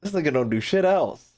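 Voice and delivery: ordinary talk, nothing marked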